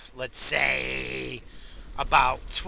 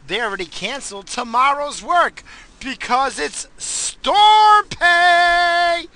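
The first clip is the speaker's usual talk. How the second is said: excited shout; 13 semitones higher, 13 dB louder, slower than usual. Real, not acted. Celebrating storm pay.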